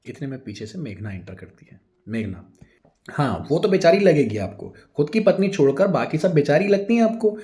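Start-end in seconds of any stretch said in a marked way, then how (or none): 3.1-7.4 loud, higher jealous tirade; angry scolding. A narrator's reading. Jealous wife yelling at her husband, loud and angry. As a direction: tear into him with jealous fury.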